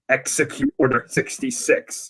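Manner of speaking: low-key sinister command; imitating hologram villain. Hologram orders the clones, imitated sinister command. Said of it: "the hologram comes up and it goes".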